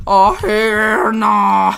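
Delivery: loud, long-drawn retold outburst; hammed-up furious holler; mock pissed off. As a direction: imitate an enraged kid, stretched out and loud. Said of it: "and he gets hella pissed".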